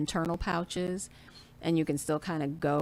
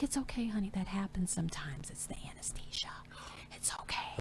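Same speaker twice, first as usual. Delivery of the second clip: nurse's gentle whisper, much softer; soothing whisper; 3 semitones higher, 9 dB quieter than usual. Retold or imitated. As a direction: whisper it like a nurse calming a patient. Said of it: "the nurse was like".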